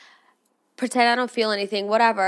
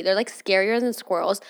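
usual speaking voice

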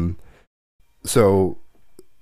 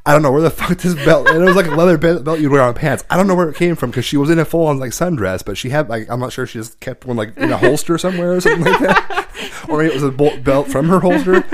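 talking through real laughter, louder and higher; laughing while telling; 5 semitones higher, 6 dB louder than usual. Real, not acted. Telling a friend about the belt, laughing hard. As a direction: giggle through the belt story.

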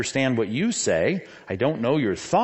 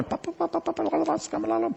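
high, quick babbled syllables mocking tongues; a hammed-up parody of ecstatic tongues; mock speaking in tongues; 14 semitones higher, 5 dB quieter, much faster than usual. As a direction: chirp pa-pa-la-la nonsense way up high, poking fun. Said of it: "just going".

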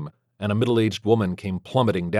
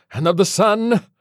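high, loud priestly cry; forceful exorcist's call; shouted invocation; 11 semitones higher, 5 dB louder than usual. Acted. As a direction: proclaim it over the blasts. Exorcism formula continues.

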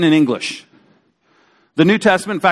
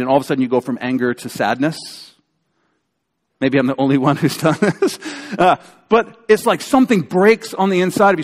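ordinary delivery

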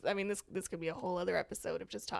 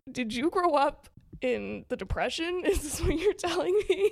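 laughing tease; 5 semitones higher, 6 dB louder than usual. Retold or imitated. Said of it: "he's like".

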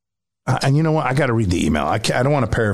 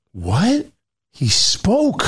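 stunned chat; 8 semitones higher, much slower than usual. Retold or imitated. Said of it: "everybody in the chat was like".

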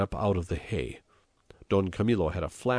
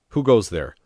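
loud, raised sentry's challenge; sudden challenge; 4 semitones higher, 10 dB louder than usual. A narrator's reading. Unseen man near the ditch, sudden challenge. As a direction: call it out suddenly and loudly. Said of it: "came a sudden voice".

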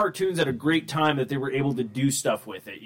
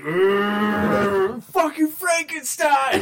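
high, slow drawn-out Frankenstein; imitating a stoned girl, high and slow; mock stoned cry; 6 semitones higher, much slower than usual. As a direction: stretch it out like someone high.

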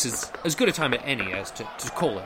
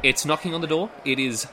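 ordinary talk

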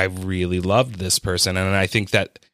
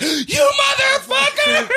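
laugh-cry quote; 4 dB louder than usual. Real, not acted. Repeating the friend's line while crying with laughter, high. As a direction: squeal it through tears of laughter.

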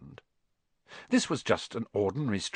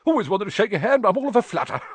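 much higher, fast, fluttery admirer; flustered fan; 9 semitones higher, 4 dB louder, faster than usual. Acted. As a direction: babble it all in a tizzy.

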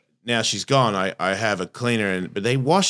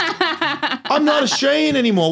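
laughing at own accent. Real, not acted. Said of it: "same as my accent".

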